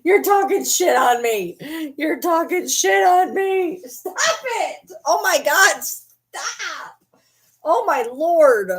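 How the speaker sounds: high, slow whiny dog voice; voicing the dog. Speaking for the dog to its owner, high whiny voice. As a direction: whine it as the offended puppy. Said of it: "She's like".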